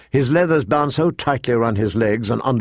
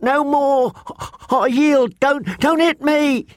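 higher, frantic and broken; shrill pleading; 13 semitones higher, slower than usual. A narrator's reading. Fat boy on the ground begging not to be hit, shrill and higher. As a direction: beg for mercy up high. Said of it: "he shrilled".

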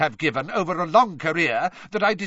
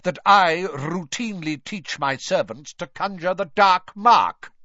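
precise icy anger, building louder; coldly angry Mister Crouch; 8 semitones higher, 7 dB louder than usual. A narrator's reading. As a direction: bite out each syllable, ending loud. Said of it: "cold anger in every syllable".

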